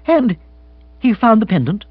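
high, halting incredulous question; shocked, loud and broken up; stunned disbelief. Acted. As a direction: struggle to get the question out. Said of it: "Bill found speech, though with difficulty".

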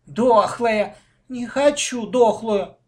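high, loud, whiny refusal; drawn-out protest; disgusted refusal. A narrator's reading. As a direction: reject it like a fussy child. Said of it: "Женечка скривила губы".

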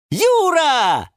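high, loud cheer of a name; jumping-for-joy yell; joyful shout. A narrator's reading. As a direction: cry out in delight. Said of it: "закричали и запрыгали все сразу".

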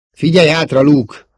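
loud, high warning call; urgent warning. Acted. Pilot warning Luke of danger behind, urgent. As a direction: shout to a friend to look behind.

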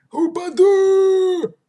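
high, drawn-out terrified shout; panicked yell. A narrator's reading. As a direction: scream it in panic. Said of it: "панически заорал он".

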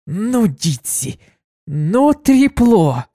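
slow drawn-out mock outrage; indignant. Kuna scolds absent Ditsy for gossiping, indignant. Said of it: "возмутилась Куна".

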